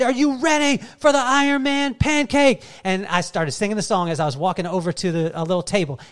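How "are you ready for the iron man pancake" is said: dad whipping up his son's excitement, loud and high; big hype call. Retold or imitated. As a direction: build it like a ringside announcer. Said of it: "I said".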